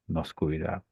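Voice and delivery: quiet answer. Shown as a demonstration.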